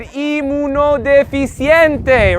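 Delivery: inmunodeficiente drawn out slowly, ending on ente; slow teacher model. Shown as a demonstration. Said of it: "very good".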